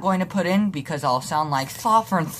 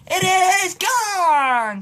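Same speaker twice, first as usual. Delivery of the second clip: high home run call; announcer call; 11 semitones higher, 4 dB louder, much slower than usual. Retold or imitated. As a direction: shout it like the TV announcer. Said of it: "says Tom McCarthy".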